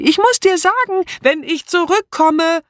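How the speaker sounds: very high, carrying shout; urgent calling out. A narrator's reading. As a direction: call out over the noise of a departing car. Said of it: "rief sie". (dringend und hoch gerufen)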